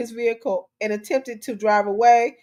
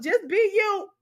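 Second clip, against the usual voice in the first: high sarcastic sing-song; mocking quote; 9 semitones higher, slower than usual. Host mocking the just-be-you slogan, scornful. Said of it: "everybody walking around talking about".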